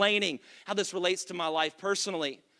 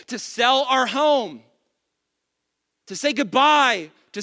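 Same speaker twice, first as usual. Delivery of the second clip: loud, driving sermon delivery; fired-up pastor building each line; full-force preaching; 4 semitones higher, 5 dB louder than usual. Acted. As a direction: preach it out to the back row.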